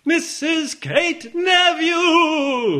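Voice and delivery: very high, loud, slow hype call; hype man intro. Pretend. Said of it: "Let me try it again".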